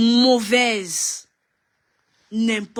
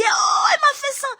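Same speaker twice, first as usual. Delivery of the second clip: very high mock cry of outrage; mock wailing victim. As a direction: wail it like a fake victim. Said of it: "Les premiers à aller crier". (fausse victime qui pleurniche, très aiguë)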